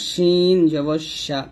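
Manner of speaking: long slow শিন with full sh; mid-tongue to palate on শিন; hushing shin. Shown as a demonstration. Teacher recapping palate contact, hushing shin. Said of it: "শিনটা জিহ্বার মধ্যখান".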